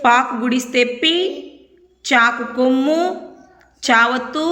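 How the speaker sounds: ordinary talk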